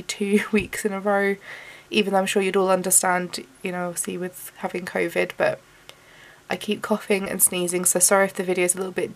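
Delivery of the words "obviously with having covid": normal talking voice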